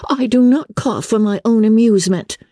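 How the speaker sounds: put-on high, fretful young girl. A narrator's reading. Kitty defending her cough to her parents, fretful. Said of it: "replied Kitty fretfully".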